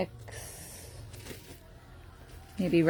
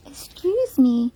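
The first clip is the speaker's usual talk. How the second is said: mock scolding; 6 semitones higher, 4 dB louder than usual. Real, not acted. Scolding a puppy chewing her jeans, playfully high. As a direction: tease the puppy like a fake-stern mom.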